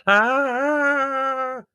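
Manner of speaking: a sudden high trance-like "Ah!"; dramatic medium's cry. Acted. Fake medium opening her séance with a high dramatic cry. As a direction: cry it out high, staged and theatrical. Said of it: "extracting the full dramatic possibilities".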